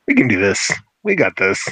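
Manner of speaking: deep muffled sea monster. Acted. Kraken voice, GM announces it.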